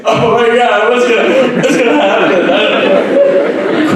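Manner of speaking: high, laughing mock panic; imitating a teary panic; half-laughing mock fear; mock panicked sob. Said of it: "I'm sobbing in that moment".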